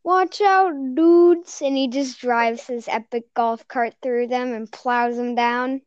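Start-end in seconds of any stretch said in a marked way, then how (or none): 0.0-1.6 higher, louder quoted call; Soos. Acted. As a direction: call out a warning in character. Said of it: "where he's like".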